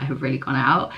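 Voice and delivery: ordinary talk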